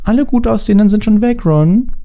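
put-on high-pitched, pert girl voice; snippy know-it-all girl. A narrator's reading. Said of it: "sagte Hermine schnippisch".

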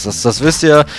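normal talking voice

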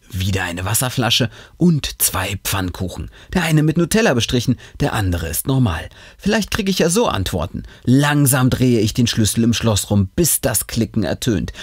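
ordinary delivery, nothing marked

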